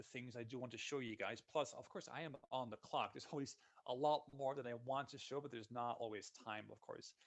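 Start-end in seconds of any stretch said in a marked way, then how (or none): none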